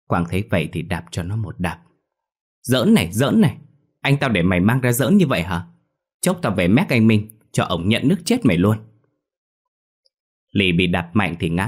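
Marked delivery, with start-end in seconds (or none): 2.6-8.8 put-on angry raised boy's voice, louder and higher; a kid yelling at a friend who mocks his dead brother; furious boy. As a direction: snap at your friend for the cruel joke. Quang furious, kicks Lì.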